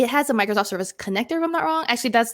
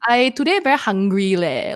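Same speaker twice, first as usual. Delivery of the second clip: casual, loose Singaporean delivery; Singlish accent; 4 dB louder than usual. Shown as a demonstration. Host shows careless pronunciation of every letter, in a Singaporean accent. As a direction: drop the careful enunciation, speak Singlish. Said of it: "the Singaporean style of talking".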